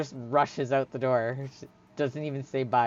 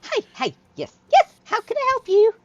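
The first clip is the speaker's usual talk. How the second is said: very high, loud squeaky greeting; eager gnome shopkeeper; 12 dB louder than usual. Acted. Gnome at the counter greeting customers, eager. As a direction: pipe up loudly in tiny bursts.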